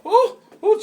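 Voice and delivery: high laughing yelp; startled laughing yelp. Real, not acted. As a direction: jolt up in a nervous laugh. Startled by the bird.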